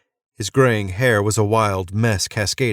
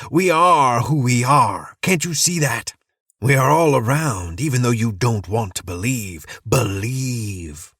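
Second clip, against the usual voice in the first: manic ranting preacher; 4 semitones higher than usual. Acted. Madman mocking the soldier, gleeful manic rant. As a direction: rave like a madman who loves his conspiracy. Madman insists on his group.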